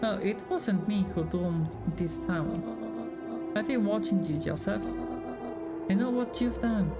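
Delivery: normal talking voice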